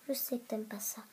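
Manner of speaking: hushed whisper to the hamster. Real, not acted. Whispering to her hamster Cerise while holding her. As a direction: breathe it softly so she stays calm. Soothing hamster she holds.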